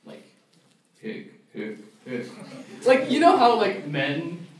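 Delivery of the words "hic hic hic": low, soft hiccup soundbite; recorded hiccups. Shown as a demonstration. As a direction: hiccup softly for a dating profile. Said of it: "record yourself hiccuping".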